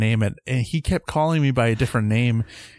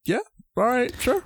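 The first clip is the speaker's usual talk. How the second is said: high-pitched, hesitant agreement; imagined sheepish acceptance; playing along; 10 semitones higher than usual. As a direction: accept it uncertainly, pitch lifted. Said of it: "where I would have been like".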